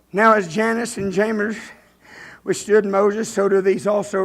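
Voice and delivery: slow, measured Bible reading; scripture reading. Real, not acted. Preacher reading verse eight to the church, slow and measured. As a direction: read the verse deliberately.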